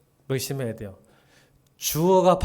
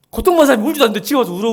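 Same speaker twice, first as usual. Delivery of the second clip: excited; 8 semitones higher, 10 dB louder, much faster than usual. Real, not acted. Excited rapid preaching.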